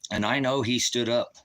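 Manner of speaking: ordinary delivery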